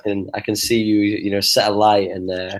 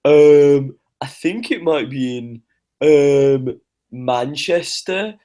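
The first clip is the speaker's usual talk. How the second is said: high, slow dopey guessing voice; clueless American guess; 3 semitones higher, much slower than usual. Retold or imitated. Mocking an American who gets Buckingham Palace wrong, dopey and slow. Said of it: "If you turn around and went".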